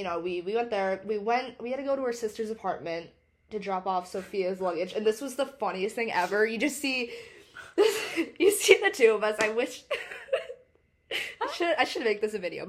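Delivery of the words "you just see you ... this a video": laughing. Real, not acted. Laughing through the funny story.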